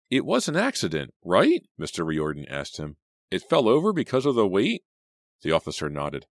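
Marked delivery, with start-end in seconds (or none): 0.1-1.7 high, loud anxious question; anxious hope. A narrator's reading. Teacher anxiously asks the officer, high and loud. 3.3-4.8 high, loud anxious question; anxious hope. A narrator's reading. Teacher anxiously asks the officer, high and loud.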